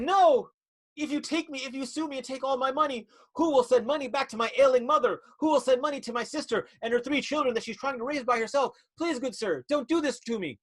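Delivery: high, trembling-free melodrama plea, pitched well up; pleading heroine. Acted. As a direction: beg the villain like a silent-film damsel.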